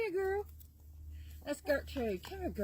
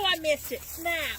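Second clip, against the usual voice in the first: frustrated exclaim; 4 semitones higher, 8 dB louder, slower than usual. Real, not acted. Missing the catch.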